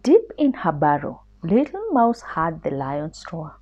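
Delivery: usual speaking voice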